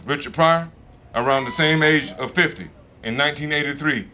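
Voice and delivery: ordinary talk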